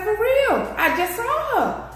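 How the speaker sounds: high insisting voice; excited insistence. Retold or imitated. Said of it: "I was like".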